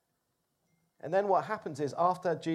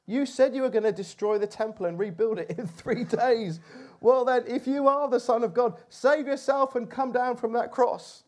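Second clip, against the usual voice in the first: higher, louder scornful challenge; mocking taunt; 6 semitones higher, 4 dB louder than usual. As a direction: dare him, dripping with scorn. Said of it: "shouted abuse".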